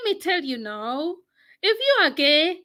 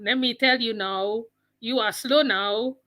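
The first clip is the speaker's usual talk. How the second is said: ordinary talk, nothing marked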